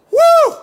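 very high loud woo; joyful whoop. Retold or imitated. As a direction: let out a church whoop of joy.

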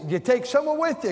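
high, fast, disbelieving repeat of the question; incredulous repeat. Retold or imitated. Pastor pressing his friend on travelling alone, high and fast. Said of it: "I said".

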